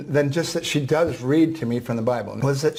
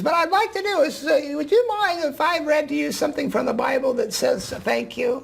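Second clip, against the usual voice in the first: put-on old woman voice; 14 semitones higher than usual. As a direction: voice the ninety-four-year-old gently. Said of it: "I'm gonna use a voice that s-, sounds like her".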